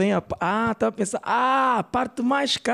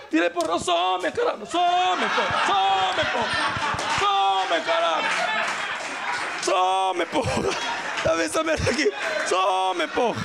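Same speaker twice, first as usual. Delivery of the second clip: higher, shouted protest; indignant yelling; 5 semitones higher than usual. Acted. Comic yelling at the audience that he is a man. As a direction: shout it out, offended.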